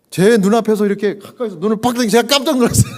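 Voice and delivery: high, loud startled laugh-talk; laughing surprise. Real, not acted. Pastor recalls patient's eyes snapping open, laughing loudly. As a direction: laugh through the shock of it.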